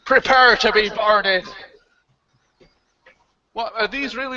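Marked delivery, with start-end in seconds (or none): 0.1-1.5 slow menacing pirate. Shown as a demonstration. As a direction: announce it like a pirate captain. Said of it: "These are awful".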